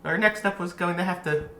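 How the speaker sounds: high-pitched fairy voice. Acted. Fairies giving their verdict to the entertainers, high-pitched. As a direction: pronounce judgement in a high little fairy voice. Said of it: "They go".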